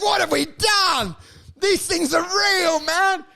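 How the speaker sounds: high-pitched hammed-up anguish; mock horror. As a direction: cry out high as an acted horrified onlooker. Horrified onlooker rails at the makers.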